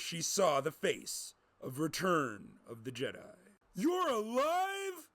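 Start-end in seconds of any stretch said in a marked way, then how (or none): 3.7-5.1 surprised. Acted. Scott shocked to see Jedi.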